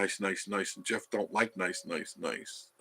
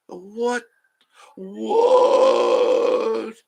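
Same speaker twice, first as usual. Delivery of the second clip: high, loud, stretched "what"; stunned disbelief; 12 semitones higher, 9 dB louder, much slower than usual. Retold or imitated. Retelling the moment the water stopped, in loud high disbelief. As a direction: shout it in disbelief.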